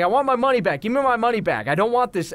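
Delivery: high, insistent customer demand; retold angry yelling. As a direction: imitate an irate customer demanding action. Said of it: "Please stop yelling".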